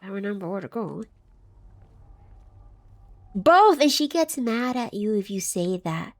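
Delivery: ordinary talk, nothing marked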